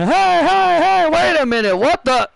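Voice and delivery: startled commentator. Acted. Commentator reacts to Ruby Soho's entrance, startled. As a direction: call out in disbelief at the entrance.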